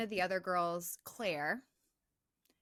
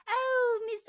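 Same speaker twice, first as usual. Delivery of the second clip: put-on much higher and louder, giddy girls; gushing excitement; 14 semitones higher, 8 dB louder than usual. Girls gushing over Missus Mack's present, high and excited. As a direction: squeal it like delighted schoolgirls.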